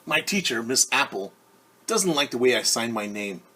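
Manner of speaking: lively picture-book read-aloud; reading to children. A narrator's reading. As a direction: read it brightly to a class of kids.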